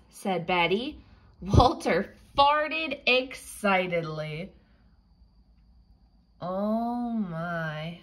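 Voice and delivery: ordinary delivery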